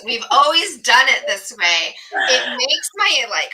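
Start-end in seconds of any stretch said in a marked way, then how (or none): 0.0-1.9 "We've always done it this way" said loud and fed up; exasperated quote. Retold or imitated. Host venting about a hated phrase, loud exasperation. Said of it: "Ugh, that phrase".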